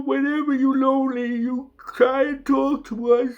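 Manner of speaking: a presence from beyond promising to come when called; channeled entity reassuring. Acted.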